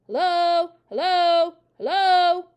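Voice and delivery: loud, high parrot calls; talking parrot. A narrator's reading. Parrot suddenly talking for two waiting children, loud parrot voice. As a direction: squawk each greeting out identically. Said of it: "the parrot said".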